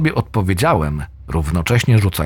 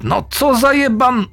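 frustrated curse. Acted. Frustrated curse as throws keep failing.

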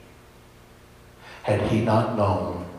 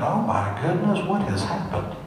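high, rising worried reaction; alarmed concern; 8 semitones higher than usual. Retold or imitated. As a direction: replay a startled, anxious thought in a raised pitch. His alarmed thought at neighbour's tears.

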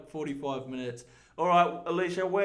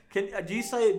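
ordinary talk, nothing marked

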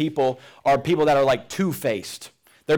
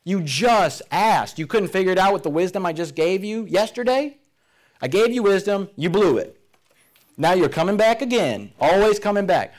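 mock annoyed God; 5 semitones higher than usual. Preacher voicing an imagined irritated God to the congregation, mock annoyed. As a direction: play an irritated God fed up with being asked again. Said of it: "God doesn't sit up there and go".

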